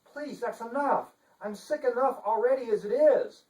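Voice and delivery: high, prim and indignant; a dainty lady playing at outrage; fussy chancellor. Chancellor protesting to the detective, high and prim. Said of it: "Funderburk said".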